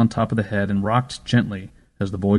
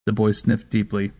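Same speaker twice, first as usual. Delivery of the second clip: ordinary delivery, nothing marked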